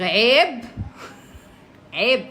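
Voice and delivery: loud shame on you; mock scolding. Real, not acted. Streamer telling viewers off loudly. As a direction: scold the chat half-jokingly.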